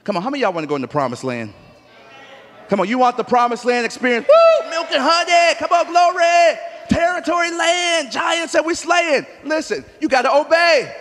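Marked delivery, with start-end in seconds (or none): 2.7-10.9 loud, high, shouting call-and-response; preaching at full force. Acted. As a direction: whip the congregation up with shouts.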